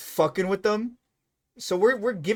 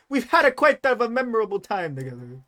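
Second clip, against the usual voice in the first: hammed-up Italian accent, higher and louder; cartoonish Italian accent, pitched up; horrible Italian accent; 7 semitones higher, 6 dB louder than usual. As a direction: put on a bad, lilting Italian accent. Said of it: "That was a horrible Italian accent".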